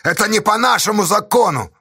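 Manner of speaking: high-pitched protesting cry; indignant shout. A narrator's reading. Pozdey objecting over the law, indignant shout. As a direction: protest at the top of your range. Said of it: "возмущённый крик. Кричал Поздей".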